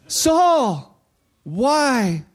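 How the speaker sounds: loud, high call of Saul, then why; heavenly call. Retold or imitated. Voice from heaven calling Saul, loud and high. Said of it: "voice from heaven".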